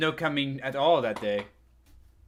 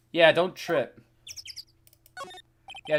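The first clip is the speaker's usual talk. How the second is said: usual speaking voice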